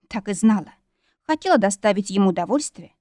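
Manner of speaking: usual speaking voice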